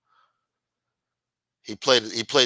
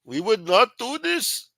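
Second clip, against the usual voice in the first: put-on high, loud, slow Pakistani accent; drawn-out, high-pitched mock Pakistani; accidental Pakistani accent; 12 semitones higher, 5 dB louder than usual. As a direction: attempt a president's denial and land in a Pakistani accent. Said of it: "No, that was Pakistani".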